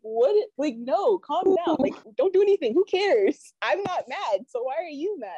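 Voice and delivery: high-pitched retold pleading; exasperated pleading. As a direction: act out talking a furious friend down. Retold calming of furious friend.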